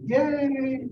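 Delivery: retold kids' gleeful yay; mock cheer. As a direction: cheer in glee at the news. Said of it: "They say".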